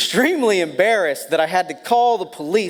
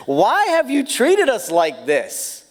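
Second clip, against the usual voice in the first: Mary's rebuke; 6 semitones higher than usual. Retold or imitated. Mary rebuking her son Jesus, mildly exasperated. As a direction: scold him lightly, slowing down. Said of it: "She says".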